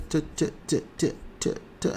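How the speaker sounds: ordinary talk, nothing marked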